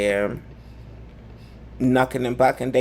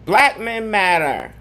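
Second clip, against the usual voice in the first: mock slogan; 7 semitones higher, 5 dB louder than usual. Real, not acted.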